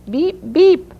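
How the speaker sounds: high, punchy car-horn beeps; imitated horn honk. A narrator's reading. Mister Cameron honks at the blocked road, high beeps. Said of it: "honked his horn".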